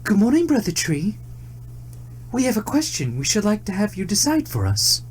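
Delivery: high, bright greeting; polite deer. A narrator's reading. Deer greeting the tree, high polite voice. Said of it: "the deer said".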